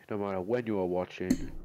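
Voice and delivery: normal talking voice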